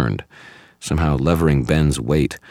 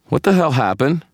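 loud, high, urgent question; alarmed demand; 5 dB louder than usual. A narrator's reading. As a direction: demand an explanation at once. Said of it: "demanded one of the officers".

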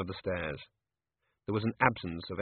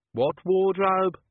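slow, raised puzzled question; slow raised query; 10 semitones higher, 7 dB louder, much slower than usual. Acted. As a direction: drag out the question. Cat's Eye asks.

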